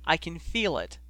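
lightly acted tearful insistence; mildly tearful; sobbed. A narrator's reading. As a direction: insist with a hint of a sob. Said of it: "Ginny sobbed".

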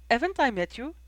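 put-on high, questioning girl's voice; puzzled young woman's query; curious. A narrator's reading. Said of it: "she asked curiously".

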